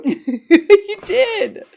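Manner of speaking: high laughing you did. Real, not acted. Answering her friend's tease, laughing high.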